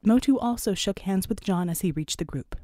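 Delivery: plain reading voice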